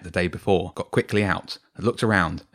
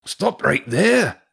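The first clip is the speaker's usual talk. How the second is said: high, loud, drawn-out command; calling out; 10 semitones higher, 5 dB louder, slower than usual. A narrator's reading. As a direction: call out a halt from behind. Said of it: "a different voice called out".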